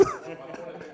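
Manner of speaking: short real airy laugh; soft breathy giggle. Real, not acted. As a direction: laugh off the joke. Joking Zach must be from Texas.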